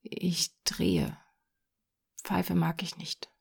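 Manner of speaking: put-on soft, hesitant boy; timid. A narrator's reading. Boy admits to the man he smokes, timidly. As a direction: own up to it shyly. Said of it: "sagte zaghaft".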